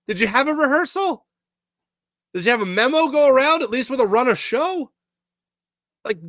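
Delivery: high-pitched, incredulous questioning; incredulous rant. Real, not acted. Host rants about the botched ceremony, incredulous. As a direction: let the voice climb in real disbelief.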